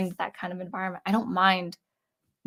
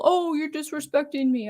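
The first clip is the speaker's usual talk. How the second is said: higher-pitched mock complaint; mock indignation; 7 semitones higher than usual. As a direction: put on an offended protest, lightly. Voices a complaint they never felt.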